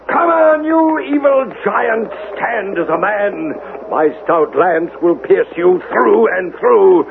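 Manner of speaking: high, ringing challenge to a foe; battle cry. Acted. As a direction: call out the giant boldly. Knight charging a giant.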